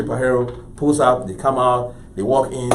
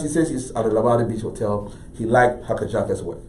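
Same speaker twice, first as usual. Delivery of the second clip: plain reading voice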